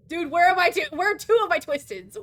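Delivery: loud, high frantic question; frantic shout. Real, not acted. Streamer loudly panics about missing enemies. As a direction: yell it in a panic.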